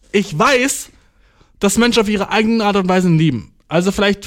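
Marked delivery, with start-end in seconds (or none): none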